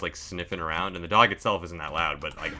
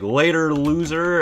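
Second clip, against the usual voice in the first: high, louder taunting puppy voice; cheeky dog voice; 9 semitones higher, 5 dB louder, slower than usual. Retold or imitated. Puppy taunting its owner while running off, high cheeky taunt. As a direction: toss it back like a smug escapee. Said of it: "It's like".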